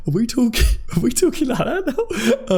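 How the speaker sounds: amused giggle while asking; laughing. Real, not acted. Streamer laughing at a viewer's Jedi talk, real laughter.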